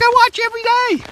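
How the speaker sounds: high, thrilled gushing; excited fan voice. Retold or imitated. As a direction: gush like an eager fan. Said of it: "She's like".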